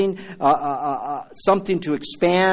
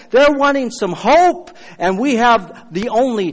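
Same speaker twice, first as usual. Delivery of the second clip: louder, higher, slowed emphasis; passionate preaching; 6 semitones higher, 4 dB louder than usual. Real, not acted. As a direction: drive it home with fervor. Preaching climax.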